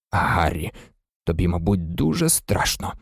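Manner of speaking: low, soft man's voice; concerned father figure. A narrator's reading. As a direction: speak gently and gravely to the boy. Worried father figure addresses boy gravely. (стурбовано, низьким тихим голосом)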